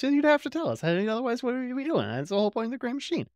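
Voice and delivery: high, wheedling mock Delenn; Delenn's pleading voice. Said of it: "Delenn's all like".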